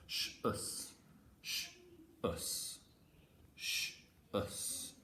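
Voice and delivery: Sh and us said apart, softly; soft segmented sh, us; sound split. Shown as a demonstration. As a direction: break sh from us slowly. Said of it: "that's the sh sounds that you can hear".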